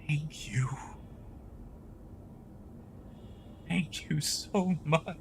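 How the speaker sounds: breathless moved whisper; whispered thanks. Real, not acted. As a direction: whisper it, overwhelmed. Overwhelmed by donations.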